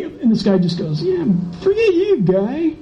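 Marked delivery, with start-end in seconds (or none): 1.0-2.7 mock dismissive driver. Driver brushing him off.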